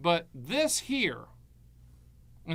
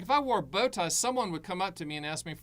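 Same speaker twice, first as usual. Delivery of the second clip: normal talking voice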